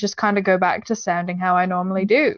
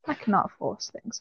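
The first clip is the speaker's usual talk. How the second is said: much softer repeat of "I cannot force things."; quiet; 11 dB quieter than usual. Real, not acted. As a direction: drop "I cannot force things." to almost nothing. Repeated, second take quieter.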